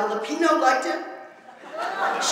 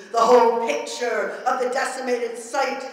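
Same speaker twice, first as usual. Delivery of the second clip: ordinary talk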